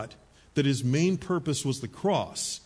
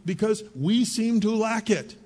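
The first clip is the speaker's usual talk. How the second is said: ordinary talk, nothing marked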